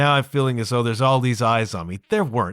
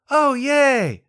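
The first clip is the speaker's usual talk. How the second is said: much higher, louder mock cheer; sarcastic yay; 11 semitones higher, 3 dB louder than usual. Real, not acted. A reluctant student mock-cheers at the next drill. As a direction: fake the enthusiasm. Sarcastic about back glides.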